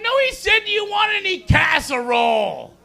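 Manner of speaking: very high, put-on exasperated voice; mock correcting friend. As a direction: correct him like a fed-up friend. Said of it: "He's like".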